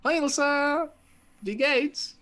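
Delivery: high sing-song greeting. Pretend. Greeting viewer in German.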